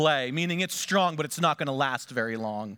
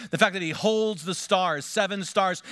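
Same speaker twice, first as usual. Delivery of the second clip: normal talking voice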